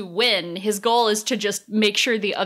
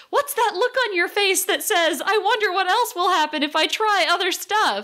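curious character voice; 10 semitones higher than usual. Retold or imitated.

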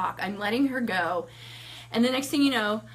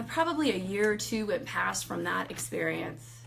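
usual speaking voice

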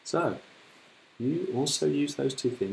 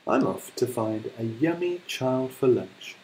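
slow, growing louder to a breathy crunch; menacing crocodile; 7 dB louder, slower than usual. Acted. Crocodile boasting of eating a child, slow menacing threat. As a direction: tease it out and bite down on crunch. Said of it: "and said".

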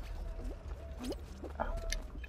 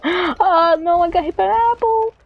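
high-pitched cry of alarm; gasp, then wail upward at the mishap; panicked gamer's yelp; dismayed wail; 9 semitones higher than usual. Real, not acted. Hit in the game.

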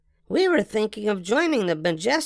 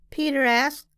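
usual speaking voice

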